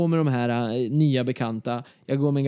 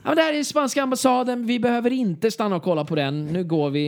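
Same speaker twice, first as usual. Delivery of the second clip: stressed inner voice; 8 semitones higher than usual. Retold or imitated. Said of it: "liksom bara".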